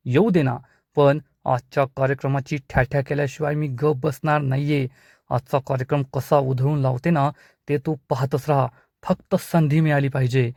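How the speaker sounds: plain reading voice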